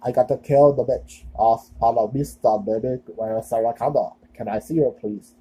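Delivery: put-on monotone movie-cyborg Austrian accent; bad Austrian accent. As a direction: imitate the robotic bad guy with an Austrian accent. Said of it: "I know that's a bad Australian, no, no, Austrian accent".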